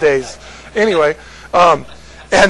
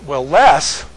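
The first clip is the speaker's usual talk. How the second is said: normal talking voice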